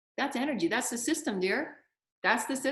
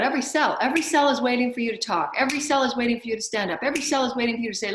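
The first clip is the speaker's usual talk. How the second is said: normal talking voice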